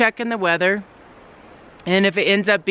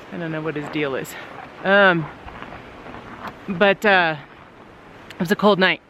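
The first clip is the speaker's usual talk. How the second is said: usual speaking voice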